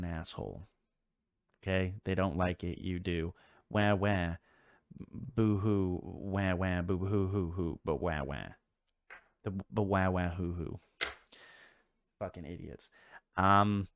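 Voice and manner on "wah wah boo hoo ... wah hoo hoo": mock wah-wah boo-hoo sobbing; fake crying. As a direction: whine "Wah, wah" like a crybaby. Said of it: "mocking whiny crybabies".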